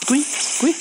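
high little cuy chirps; imitated chirps. Streamer mimicking the game, high chirps. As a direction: chirp like a tiny critter.

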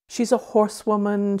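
usual speaking voice

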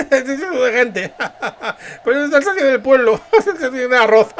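real laughter breaking through the words; laughing. Real, not acted. Dubber cracking up at who the guests are, laughing through it.

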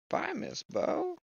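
high, slow sing-song hello; sing-song greeting. Acted. Player greets the creepy doll, sing-song high. Farewell to a game character.

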